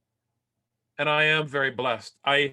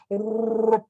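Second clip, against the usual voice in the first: a loud, higher ringing "Bong!"; bong sound effect; 6 semitones higher, 4 dB louder than usual. Retold or imitated. Dad mimics the crash for the audience, loud ringing bong. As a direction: ring it out like a struck gong. Imitating the kid hitting something.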